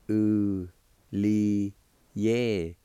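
Ulietiske broken into separate, held syllables; slow syllable-by-syllable. Shown as a demonstration. Teacher showing learners how it's pronounced, slow syllable-by-syllable. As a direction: say Ulietiske slowly, a pause between syllables. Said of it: "practice and go slow".

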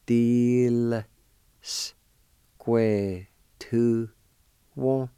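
"Deal square to one." sounded out word by word; careful pronunciation demo. Shown as a demonstration. Teacher sounding out "Deal square to one." for students, word by word. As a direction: set "Deal" apart before "square to one.". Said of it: "pronounce the L sound with the D I like this".

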